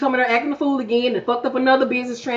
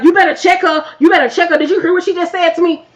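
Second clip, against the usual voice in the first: indignant; 6 semitones higher, 3 dB louder than usual. Retold or imitated. Brandy demanding husband intervene.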